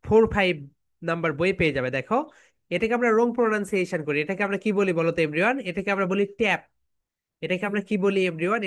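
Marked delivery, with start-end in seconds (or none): none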